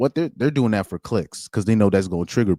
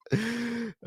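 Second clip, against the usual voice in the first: soft laugh; 11 dB quieter than usual. Real, not acted. Reacting to more slur tweets.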